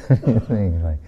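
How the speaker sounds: plain reading voice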